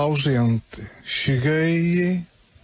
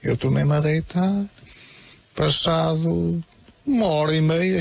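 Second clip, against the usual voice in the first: ordinary talk, nothing marked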